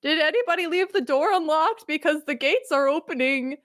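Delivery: high, timid mock voice; nervous soldier. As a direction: ask it like a jittery guard.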